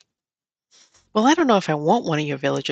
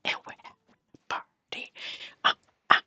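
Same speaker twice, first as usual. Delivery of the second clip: unvoiced, delighted whisper; giddy whisper; 8 dB quieter than usual. Real, not acted. Gamer celebrating her villager's departure in a whisper.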